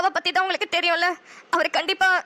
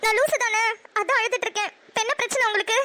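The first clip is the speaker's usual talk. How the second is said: sulky crying. Acted. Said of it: "நீ லூசு மாதிரி அழுதுட்டு இருக்க".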